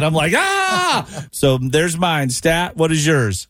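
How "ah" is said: high-pitched retold kid's scream; mock scream. Host re-enacts screaming for mom and dad, high mock scream. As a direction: cry out like a scared kid, played up.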